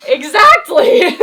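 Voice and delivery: loud, drawn-out exclamation, pitched high; emphatic agreement. Real, not acted. Partner agrees over laughter, emphatic and loud. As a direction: cry it out in real triumph, stretching the word.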